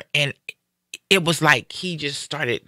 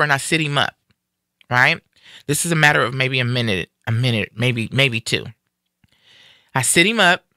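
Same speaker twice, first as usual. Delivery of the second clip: ordinary delivery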